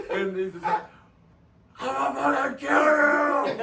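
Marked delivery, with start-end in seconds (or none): 1.8-3.5 high, loud, slow furious scream; screaming threat. Retold or imitated. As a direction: bellow it at the top of your lungs. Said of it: "He was screaming at top of his lungs".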